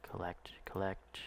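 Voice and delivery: whispered under the breath. Real, not acted. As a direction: murmur it to yourself, voiceless. Chanting while collecting cargo.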